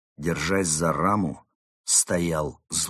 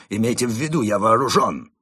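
raised, insistent threat; warning demand; 2 semitones higher, 5 dB louder than usual. A narrator's reading. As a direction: warn them off firmly. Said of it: "потребовал он".

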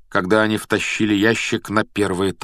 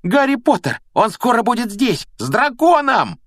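very high, loud tattling squeal; 4 dB louder than usual. A narrator's reading. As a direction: squeal out your accusation at the top of your range. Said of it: "визжал Малфой". (визгливо, очень высоко и громко)

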